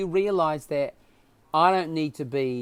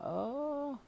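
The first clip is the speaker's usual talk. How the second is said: high, soft, stalling noises of a man caught off guard; awkward hesitant reply; 11 semitones higher, 13 dB quieter, much slower than usual. Retold or imitated. Telling his son the radio offer, caught unsure and hesitant. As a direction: let it hang, lost for words. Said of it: "I was like".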